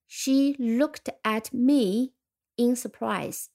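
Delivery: very slow She looked at me in surprise; slow example reading. Shown as a demonstration. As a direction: read the example slowly for learners. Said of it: "我们可以说". (放慢速度朗读例句)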